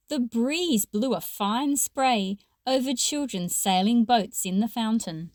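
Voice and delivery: ordinary delivery, nothing marked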